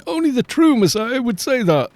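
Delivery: high, eager zealot voice; crowd of believers. Retold or imitated. The crowd insists to Brian, in a high eager voice. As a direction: gush it like a true believer.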